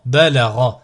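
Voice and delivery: syllables joined as one word. Shown as a demonstration. Teacher models a word for learners to repeat, joined in one go. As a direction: run the syllables together without pauses. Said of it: "le mot attaché".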